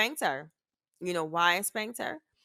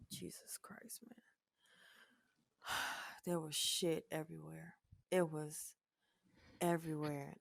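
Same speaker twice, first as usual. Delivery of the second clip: breathless hushed disbelief; stunned whisper; 3 semitones lower, 16 dB quieter than usual. Real, not acted. Mother recalling the bathroom mess, awed whisper. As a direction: whisper it at a horrible sight.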